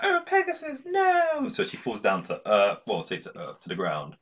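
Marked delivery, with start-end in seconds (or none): 0.0-1.5 high hammed-up wail of despair; mock dramatic scream as Pegasus burns; mock anguished cry. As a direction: cry out over the burning horse. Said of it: "she's like".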